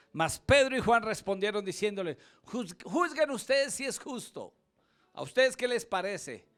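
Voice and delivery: loud, high preacher's proclamation; preaching at full force. Acted. Preacher to congregation, loud fervent proclamation. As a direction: thunder the apostles' answer to the council.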